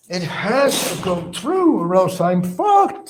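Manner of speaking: high, drawn-out mock worry; slow, high mimicry of a worried man; imitating Barry worrying. Voicing Barry's worry over the complaint, mock-worried. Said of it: "he's thinking".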